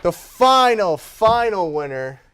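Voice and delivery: high, loud winner announcement; game show host call. Acted. Host to the winning player, game show excitement. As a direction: crown the champion like a quiz host.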